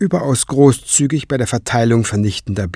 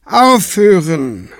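slow, drawn-out, higher-pitched stop; sighed command; 7 semitones higher, 4 dB louder, slower than usual. A narrator's reading. Tenebrae halting the squabble between clerics, sighing. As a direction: stretch it out in weary exasperation. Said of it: "seufzte Tenebrae".

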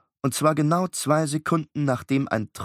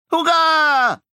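much higher, loud joyful shout; cheering; 8 dB louder than usual. Acted. Beckett cheering at story time, loud joyful shout. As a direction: cheer with all you have. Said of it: "rief Beckett".

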